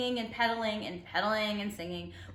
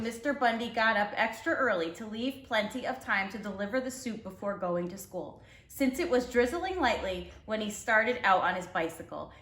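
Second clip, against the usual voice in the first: ordinary delivery, nothing marked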